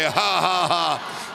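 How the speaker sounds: deliberate ha-ha for others to copy; demonstrated forced laugh. Shown as a demonstration. Preacher showing the congregation how to laugh, deliberate. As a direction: lead a made-up laugh. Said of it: "go ahead and laugh a little bit and say".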